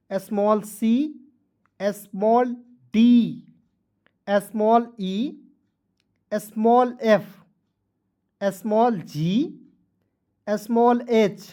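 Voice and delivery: drawn-out "small" before each letter; patient dictation to beginners; very slow classroom recitation; slow letter drill. Shown as a demonstration. Reciting small letters slowly.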